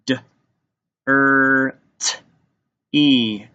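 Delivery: "D, er, t, e" as four separate sounds; sounded out. Shown as a demonstration. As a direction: tap out each sound: "D, er, t, e", pauses between. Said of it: "Let's tap it out".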